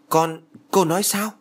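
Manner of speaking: staring in shock, voice shooting up; stunned disbelief. A narrator's reading. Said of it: "trố mắt".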